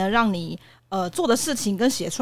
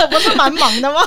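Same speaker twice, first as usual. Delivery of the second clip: hurt protest; 6 semitones higher, 8 dB louder than usual. Retold or imitated. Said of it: "我那时候也是蛮受伤的".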